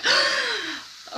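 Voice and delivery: high, sharp mock-offended gasp; affronted gasp. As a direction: gasp as if scandalised. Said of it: "flipping affronted".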